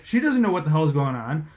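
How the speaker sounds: ordinary talk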